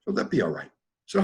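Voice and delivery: normal talking voice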